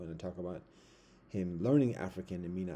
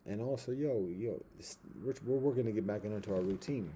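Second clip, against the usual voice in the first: normal talking voice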